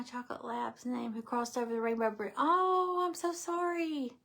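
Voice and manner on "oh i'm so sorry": high, drawn-out condolence; long, high "oh" of sympathy; heartfelt sympathy. Real, not acted. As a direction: console tenderly in a raised pitch, slowly. Consoling viewer over dead dog.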